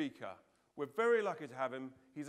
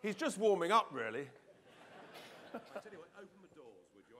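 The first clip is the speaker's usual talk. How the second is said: ordinary talk, nothing marked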